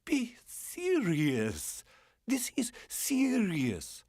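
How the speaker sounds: high, slow, pleading woman's voice; woman pleading with her lover, tearful and slow; sobbing plea. Acted. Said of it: "Sobbing, she pulled herself free".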